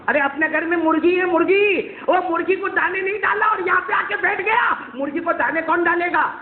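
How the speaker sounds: high, shouting mother scolding her son; furious mother shouting in front of everyone. Acted. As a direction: yell at him for forgetting the chickens.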